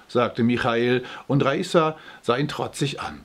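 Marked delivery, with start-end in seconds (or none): none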